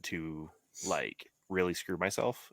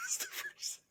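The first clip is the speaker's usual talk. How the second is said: laughing; 11 dB quieter than usual. Real, not acted. Echoing a joke while laughing.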